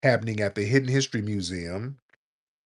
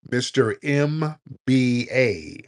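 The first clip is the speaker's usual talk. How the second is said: slow, stretched-out summons, louder; drawn-out call; 3 semitones higher, 5 dB louder, much slower than usual. Real, not acted. Host calling an unresponsive caller onto the show, drawn out. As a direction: call out each letter of M B A slowly.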